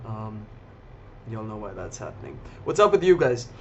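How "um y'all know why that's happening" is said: low, soft words through a yawn. Real, not acted. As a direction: talk while stifling a yawn. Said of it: "if y'all hear me yawn".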